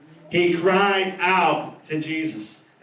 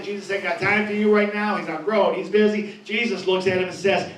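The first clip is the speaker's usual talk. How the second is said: normal talking voice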